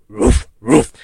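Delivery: loud, low woofing big dog; booming dog woof; big dog barking. Retold or imitated. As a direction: woof like a great dane. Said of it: "And the big dog".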